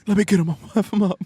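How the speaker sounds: high, loud hammed-up threat; angry player impression. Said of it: "that's my Cat impression".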